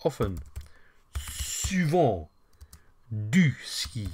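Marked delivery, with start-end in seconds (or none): 1.2-2.3 souvent, said higher, French nasal end; French model word. Shown as a demonstration. Teacher modelling souvent for the class, higher. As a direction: sound out souvent for learners. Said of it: "Je fais, I do, often".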